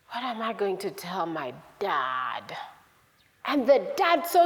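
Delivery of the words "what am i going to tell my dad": slow, low, half-whispered worry; soft, drawn-out fretting; worried hushed musing. Acted. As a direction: murmur it slowly with dread. Son dreads facing his father.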